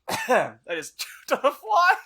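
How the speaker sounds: high laughing through the words. Real, not acted. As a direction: laugh while you talk. Laughing after choking on fly.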